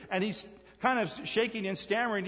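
ordinary delivery

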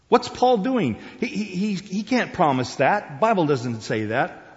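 mock outraged heckler from the congregation; objecting listener. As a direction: blurt it out as an indignant objector. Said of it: "people sitting in the audience that are saying".

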